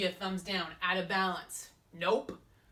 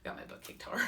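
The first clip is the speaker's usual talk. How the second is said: whisper; 5 dB quieter, faster than usual. Real, not acted. Confessing quietly.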